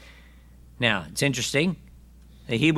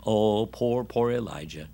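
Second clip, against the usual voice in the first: mock pity; 2 semitones lower, 4 dB quieter, slower than usual. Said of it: "No, God says".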